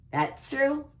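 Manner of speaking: normal talking voice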